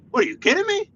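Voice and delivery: high, incredulous yelp at the sign; outraged disbelief. Real, not acted. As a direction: act like the sign insulted you.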